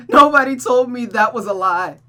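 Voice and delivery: loud, high sobbed realisation; sobbing. Acted. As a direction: cry out the betrayal. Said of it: "She continued sobbing".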